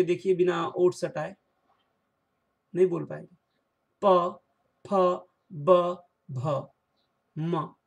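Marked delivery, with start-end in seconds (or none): 4.0-7.7 प फ ब भ म each said separately with the lips; labial series. Shown as a demonstration. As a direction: sound out प फ ब भ म one by one. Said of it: "इन वर्णों को बोलने के लिए". (होंठों से प फ ब भ म बोलकर दिखाना)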